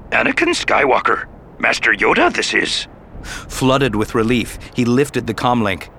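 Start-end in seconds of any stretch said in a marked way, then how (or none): 0.1-2.9 put-on a touch of the old Jedi master; Yoda voice. Yoda calls Anakin on the comlink, lightly voiced.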